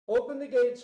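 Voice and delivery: high loud call. Acted. King orders guards to open gates, loud call. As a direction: shout the order across the stockade.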